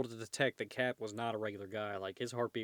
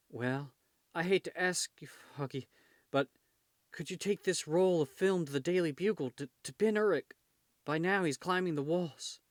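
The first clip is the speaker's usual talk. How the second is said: higher-pitched attempt at Irish; lightly tried Irish accent, pitched up; 6 semitones higher, 4 dB louder than usual. Injured photographer asks Foggy a favor, in an attempted Irish accent. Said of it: "I was trying to do an Irish accent there".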